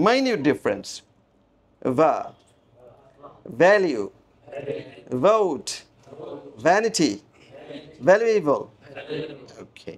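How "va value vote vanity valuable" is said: ভ্যালু, ভোট, ভ্যানিটি with English V, teeth on lip; V sound demo. Shown as a demonstration. Teacher drilling the English V, careful word-by-word demo. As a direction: isolate each word, starting ভা with a V. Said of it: "ভিটা হয় ভা".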